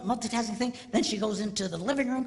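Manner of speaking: normal talking voice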